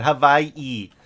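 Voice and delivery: Hawaii spoken as "Ha-vai-ee", the W turned into a V; a clear model "Ha-vai-ee" for Hawaii. Shown as a demonstration. Telling viewers how to say it, "Ha-vai-ee" for Hawaii. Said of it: "you gotta say it like that".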